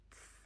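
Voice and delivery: the German letter z said "تس", a t run into s; "تس" with t and s joined. Shown as a demonstration. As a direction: say "تس" as one sharp sound between t and s. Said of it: "چون زد توی آلمانی یه چیزی بین ت و".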